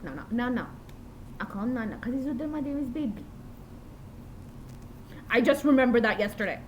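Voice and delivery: ordinary delivery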